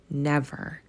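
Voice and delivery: soft, small single word; meek answer. A narrator's reading. Timid Scrooge answering the ghost, soft and meek. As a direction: barely dare to answer. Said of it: "Scrooge made answer".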